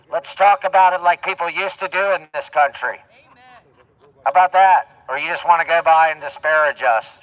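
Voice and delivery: loud street challenge through a megaphone; bullhorn shouting. Real, not acted. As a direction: call her back over through the bullhorn. Calling out to passing woman.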